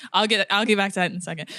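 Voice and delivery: ordinary delivery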